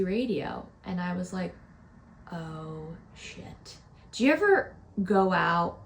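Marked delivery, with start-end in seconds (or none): 2.3-3.8 breathy dread under the breath; horrified whisper. Retold or imitated. Waking up to a sent memo, whispered dread. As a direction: mouth a quiet oh shit at the phone.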